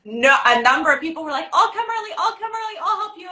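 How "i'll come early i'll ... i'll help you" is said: very high-pitched, mimicked eagerness; eager volunteers. Mimicking people offering to help at the retreat, eager and high. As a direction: pipe up high like keen helpers.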